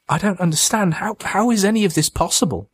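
high-pitched, disbelieving plea; distraught bewilderment. A narrator's reading. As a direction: voice climbing high as if nothing makes sense. Mitch bewildered, distraught at the creature.

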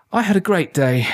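high-pitched, pleased little boast; beaming. A narrator's reading.

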